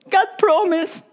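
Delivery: high, ringing call. Acted. Preacher proclaiming God's promise, pitched high. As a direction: lift it up like a proclamation.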